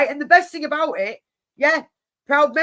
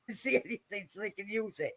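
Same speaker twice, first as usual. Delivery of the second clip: ordinary talk, nothing marked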